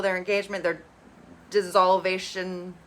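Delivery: usual speaking voice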